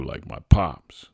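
ordinary delivery

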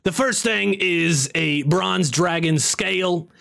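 imperfect comedian impression; Gilbert Gottfried. Wizard listing what the vault requires, imitating Gottfried. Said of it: "sounds like Gilbert Godfrey".

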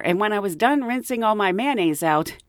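normal talking voice

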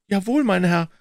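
put-on high, eager young man's voice; Offerton chiming in. A narrator's reading. Offerton interrupting Holmes with a suggestion, high and eager.

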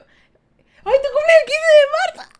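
very high, slow, squealing gush; high squealy fangirl voice. Pretend. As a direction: coo it like an overexcited fan. Reading chat, gushing over birthday.

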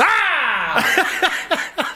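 sharp high jump-scare yell; sudden scare shout. Shown as a demonstration. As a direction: yell to startle someone. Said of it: "Scaring people".

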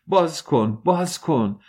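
high-pitched cries to open up; high, frightened calls; desperate shouting. A narrator's reading. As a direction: call out urgently for help through a locked door.

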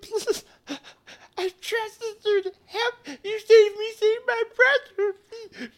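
very high, wailing sobbing voice; tearful begging. Acted. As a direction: beg through tears, breaking into loud cries. Sobbing character begs for help.

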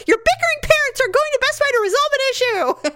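high, hammed-up calling out; mock announcement upstairs. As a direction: announce it theatrically to someone upstairs. Retold announcement called upstairs.

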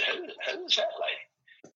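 put-on high, slow secret whisper to the kids; whispered question. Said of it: "and I said".

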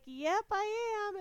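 high, drawn-out happy confirmation; proud announcement. Retold or imitated. She confirms her pregnancy to family, gleefully. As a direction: sing out the good news, stretched.

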